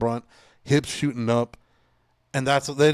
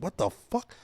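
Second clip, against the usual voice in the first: startled disbelief; 9 semitones higher, 3 dB quieter than usual. Retold or imitated. Shocked reaction to loud slam.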